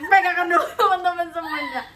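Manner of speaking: loud laugh through the words; laughing loudly. Real, not acted. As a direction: laugh while calling out. Joking about holding on together. (tertawa keras)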